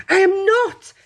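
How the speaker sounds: put-on high, loud boyish protest; indignant cry. A narrator's reading. Charlie to Grandpa Joe who doubts him, indignant cry. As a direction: cry it out sharply, high-pitched. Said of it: "cried Charlie".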